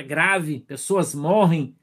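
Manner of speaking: ordinary talk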